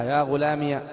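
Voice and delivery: "يا غلامي" ending in -iya; ya with fatha. Shown as a demonstration. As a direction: open the ya of "يا غلامي" with a short a. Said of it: "إثبات الياء مفتوحة".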